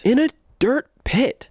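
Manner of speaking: dirt pit said high and loud; In a dirt pit, stressed with disbelief; incredulous. Real, not acted. Mocking the film's choice.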